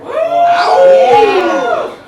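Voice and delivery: high, loud cheering yell. Retold or imitated. Bar crowd cheering the World Series win, loud whoop. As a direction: whoop like the Cubs just won.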